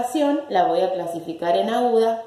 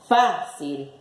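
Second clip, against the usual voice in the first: "Fácil" split, first syllable fá stressed; 3 semitones lower than usual. Shown as a demonstration. Teacher showing the written accent, "Fácil" stressed on fá. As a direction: lean on FÁ, drop cil in "Fácil".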